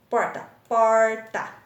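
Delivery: porta, then a loud, drawn-out POR - TA; teacher sounding out POR-TA; word, then syllables. Shown as a demonstration. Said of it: "dois pedacinhos".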